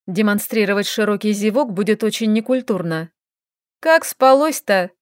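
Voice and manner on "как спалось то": sly teasing question. Acted. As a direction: tease him, drawing it out. Teasing the waking elf.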